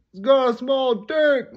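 high, loud, drawn-out jeer; heckling shout. Acted. Classmate interrupting with a jibe, heckling shout. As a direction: yell it out slowly as a classroom heckler.

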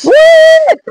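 very high, loud whoop of disbelief; thrilled squeal. Acted. Traveller just offered the job, thrilled high squeal. Acts out overjoyed reaction to job.

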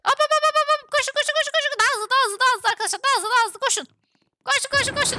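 high, rapid-fire urging; urgent egging-on. Real, not acted. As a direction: hurry the team along excitedly. Urging the team on. (telaşla ekibi hızlandırma)

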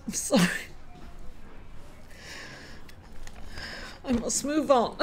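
real choked-up apology; emotional. Real, not acted. Host apologizing to viewers after her mother's death, choked up. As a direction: struggle to move on through tears. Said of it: "like an emotional wreck".

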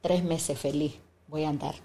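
a low, soft hopeful line; said low under the breath. Retold or imitated. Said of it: "Yo dije".

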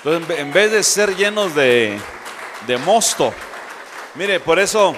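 loud, fervent pulpit cry to the congregation; full-force preaching. Real, not acted. Preacher to congregation, shouting with fervor. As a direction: preach it out at the top of the voice.

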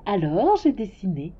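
usual speaking voice

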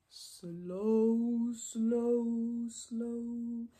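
long-drawn, monotone word; slow. Shown as a demonstration. Teacher showing children slow, long and drawn out. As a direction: hold each slow on one note. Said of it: "fast and slow".

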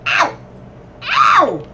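loud, high cries of ow; shouted ow. Real, not acted. Gamer hit by enemies, loud high ow.

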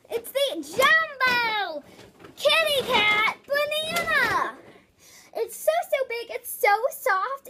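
slow, high, loud reveal; excited announcement. Real, not acted. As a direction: drag out the name like a grand reveal. Announcing the toy.

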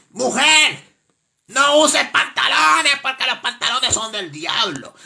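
loud, drawn-out moral warning; scolding preacher. Acted. Caller warning women against trousers, loud scolding sermon. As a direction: cry it out slowly like a fiery warning.

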